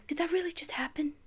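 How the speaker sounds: fast, breathless whisper; hushed, quick disbelief; awed whisper. Real, not acted. Awed reaction to the movie.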